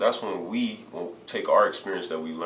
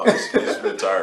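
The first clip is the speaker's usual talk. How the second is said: laughing, much higher and louder retire; 14 semitones higher, 10 dB louder than usual. Real, not acted. Retired player laughing as he confirms he is done. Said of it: "laughing confirmation".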